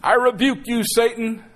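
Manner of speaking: hammed-up stern rebuke; mock rebuke. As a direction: command the devil to leave. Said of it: "when I see the word rebuke".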